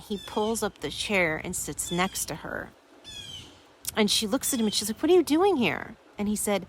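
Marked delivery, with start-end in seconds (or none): 5.0-6.0 high-pitched, acted surprise; retold astonishment, pitched well up; surprised question. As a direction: ask it as if caught off guard, voice leaping up. Retold wife surprised by husband's return.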